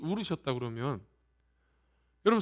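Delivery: ordinary delivery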